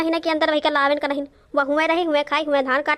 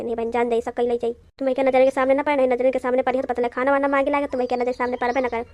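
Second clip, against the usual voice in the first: ordinary talk, nothing marked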